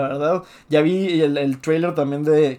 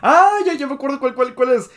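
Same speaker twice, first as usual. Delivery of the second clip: real excited "aha" moment, pitched way up; sudden recognition; 10 semitones higher, 6 dB louder than usual. Real, not acted. Friend suddenly remembering the documentary's case, excited high-pitched outburst. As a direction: burst out high and loud as it clicks.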